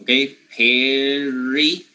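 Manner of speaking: Perry drawn out slowly, short e; Perry said slowly to show the vowel; slow demo. Shown as a demonstration. Teacher slowing down Perry for students. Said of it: "the only difference is simply the vowel sound".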